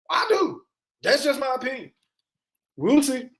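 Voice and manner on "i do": much higher, insisting harder the second time; high emphatic repeat. Real, not acted. Streamer doubling down on his pay-per-view prediction, high insistent repeat. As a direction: push it way up to insist.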